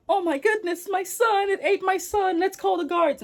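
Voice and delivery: high-pitched, hammed-up royal distress; mock panic. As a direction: play a sultan crying out in alarm. Acted sultan panics over eaten son.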